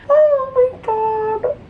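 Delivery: very high, slow squeal; squealed oh my god. Real, not acted. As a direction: squeal it out, overwhelmed. Overwhelmed by news.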